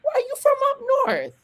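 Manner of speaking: high, fast mock Boston grandparent asking; imitating Boston grandparents. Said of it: "they would even say".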